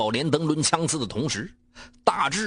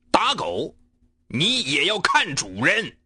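staccato; 4 semitones higher, slower than usual. Acted. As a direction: hammer each syllable separately. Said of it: "再次一字一顿地说出了这句话".

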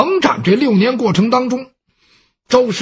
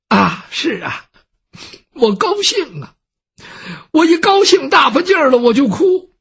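crying through the words, rising higher as it swells; tearful; 5 semitones higher than usual. Acted. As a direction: sob out the happy-sad excuse. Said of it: "您，您怎么哭了？". (老太太带着哭腔说)

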